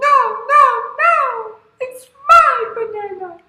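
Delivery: very high, loud squeal; protesting animals. Acted. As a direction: squeal back like a chorus of small animals. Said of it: "the monkeys and the bear".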